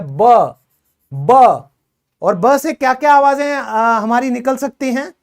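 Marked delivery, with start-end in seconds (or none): 0.0-1.3 ब said as a short clipped sound, not बी; teacher showing the letter sound ब. Shown as a demonstration. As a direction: say ब alone, crisp and short. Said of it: "ये कैसे बोला जाता है".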